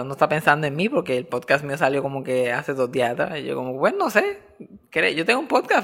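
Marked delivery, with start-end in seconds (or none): 3.7-5.8 awkward high-pitched reply. Retold or imitated. Unsure reply to a fan.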